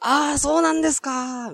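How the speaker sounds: high, stretched "あー" in an imitated voice. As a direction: mimic a lightly put-on polite acquaintance. Retold drawn-out polite reply. (軽く真似た間延びした相槌)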